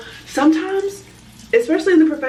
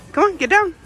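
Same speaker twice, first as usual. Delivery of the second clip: high, louder coaxing call to the dog; 10 semitones higher, 4 dB louder than usual. Real, not acted. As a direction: coax the dog off with a lifted voice. Calling her dog down.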